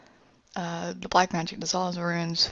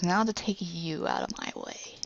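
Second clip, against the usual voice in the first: menacing villain; 6 dB quieter than usual. Retold or imitated. Villain advancing slowly.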